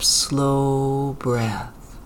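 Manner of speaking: ordinary delivery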